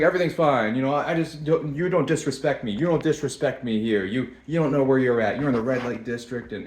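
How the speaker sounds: put-on pitch of an affronted hustler; confrontational tout. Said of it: "he kinda backed up a little bit".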